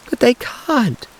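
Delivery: very high, anguished protest; protesting cry. A narrator's reading. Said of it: "cried Tessie protestingly".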